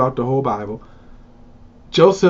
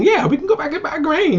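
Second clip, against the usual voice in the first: sons agreeing; 13 semitones higher than usual. Retold or imitated. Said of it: "so he says".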